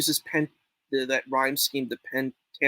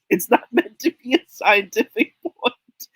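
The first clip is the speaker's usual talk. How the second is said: laughing through the words, pitched up; 7 semitones higher, 6 dB louder than usual. Real, not acted. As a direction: let the laugh break through the line. Laughs through his own line.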